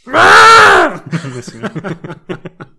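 very loud mock goat screech, breaking into laughter; imitated goat scream. Imitating goats screaming through space, loud comic screech. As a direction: scream like a goat, full blast. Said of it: "που ουρλιάζουν μες στο διάστημα".

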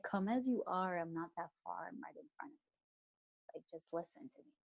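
soft reassuring divine whisper; tender God. Retold or imitated. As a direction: draw her close with a hush. God comforts her softly.

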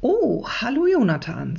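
lightly voiced kind teacher; teacher greeting. A narrator's reading. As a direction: greet the late pupil brightly. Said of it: "sagt Frau Kägele".

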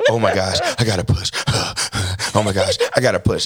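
held-back mock panic with breathy gasps; Asia flipping out. Husband retells wife needing to push, muted mock panic. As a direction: imitate a labouring wife, panting but keeping the volume down. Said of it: "Asia is flipping out".

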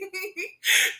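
a high laugh breaking into a cry. Real, not acted. Laughing after Friday shout.

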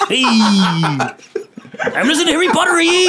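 put-on laughing through a Mexican accent; high, laughing Mexican accent. Continues the accent bit.

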